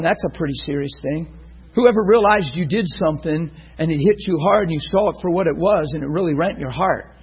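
ordinary delivery

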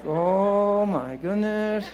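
drawn-out, stunned; a bystander caught off guard, slow and amazed; slow stunned aside. Retold or imitated. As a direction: say it slowly, half in awe. Bystanders reacting to public prayer.

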